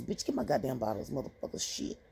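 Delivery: quiet grumbled swearing; muttered curses. Retold or imitated. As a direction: cuss them out under your breath. Cursing under breath at staff.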